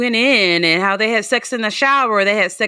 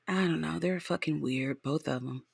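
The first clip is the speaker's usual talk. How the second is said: much softer, lower aside; under her breath; 6 semitones lower, 15 dB quieter than usual. Real, not acted. Host quietly dismissing both of them. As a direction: drop it to a near-mutter. Muttered aside about couple.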